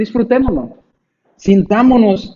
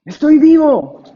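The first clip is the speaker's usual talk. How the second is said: emphatic; 5 semitones higher, 7 dB louder, slower than usual. Real, not acted.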